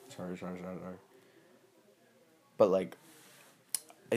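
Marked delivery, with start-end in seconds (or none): 0.1-1.0 quiet apology. Real, not acted. Apologizing to someone off camera.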